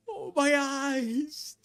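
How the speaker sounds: high, slow, drawn-out mock agony; imitated scream of pain. Mimicking the scalded girlfriend, high drawn-out cry of pain. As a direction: stretch out a hammed-up pained wail. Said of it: "Just the screaming".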